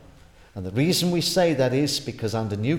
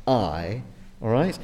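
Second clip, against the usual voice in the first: ordinary talk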